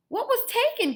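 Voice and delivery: high, loud gasped question; mother gasping at the mess; Mom gasping. Acted. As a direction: gasp it out in shock. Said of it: "gasped Mom".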